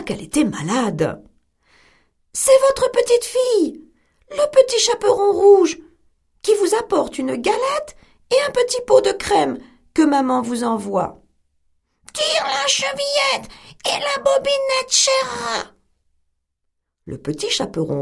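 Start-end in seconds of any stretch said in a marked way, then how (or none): 2.3-11.2 put-on higher, sweet child's voice; innocent little girl. Girl's voice in story. 12.1-15.7 much higher, thin put-on granny voice; the wolf squeaks like grandmother; wolf in bed faking the old woman; small voice.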